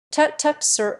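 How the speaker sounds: a mild flustered exclamation. A narrator's reading. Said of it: "exclaimed Professor Porter".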